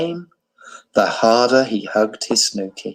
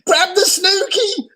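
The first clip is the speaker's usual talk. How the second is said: shouting. A narrator's reading. Giraffe and tiger shouting to Abu, very high. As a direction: yell encouragement. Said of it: "shouted the giraffe and the tiger".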